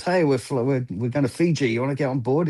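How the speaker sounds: eager invitation. Pretend. Stranger in a port bar recruiting crew, eager and upbeat. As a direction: call someone over to join an adventure.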